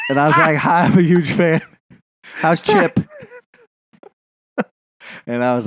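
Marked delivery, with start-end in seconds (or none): 0.6-3.0 higher, eager fanboy voice; lightly hammed-up fan greeting; mock starstruck fan. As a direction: gush up high like a superfan.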